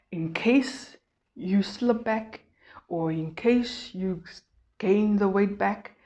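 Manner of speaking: ordinary talk, nothing marked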